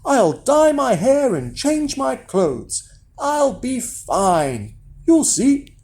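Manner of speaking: put-on high, slow boy voice; breezy young Jack, taking his time; confident Jack. A narrator's reading. Jack reassures his mother.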